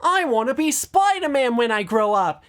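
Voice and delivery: put-on high, loud eager kid voice; excited kid. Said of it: "Joseph in part two's flashback saying".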